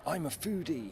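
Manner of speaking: high, mocking sing-song foodie voice. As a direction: sneer at the self-styled foodie. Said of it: "we're being told by people".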